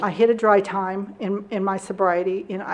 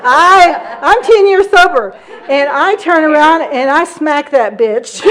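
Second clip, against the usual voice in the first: loud, high, laughing confession; howling with laughter over the smack; 5 semitones higher, 8 dB louder than usual. Real, not acted. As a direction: burst out laughing at your own punchline. Laughing climax of the fight story.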